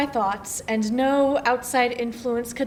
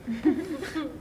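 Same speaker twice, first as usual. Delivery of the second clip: quiet little laugh to oneself; rueful laugh; 3 semitones higher, 5 dB quieter than usual. Acted. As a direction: laugh softly at your own realization. Laughs at her empty list.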